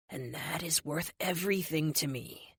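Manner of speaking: soft whispered reassurance; whispering. Acted. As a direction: keep it hushed and tender. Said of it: "he whispers".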